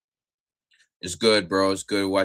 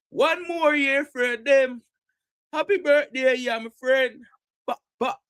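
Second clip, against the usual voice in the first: put-on Jamaican accent; 3 dB louder than usual. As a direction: sing it out in high Jamaican patois. Mimics Jamaican birthday greeting.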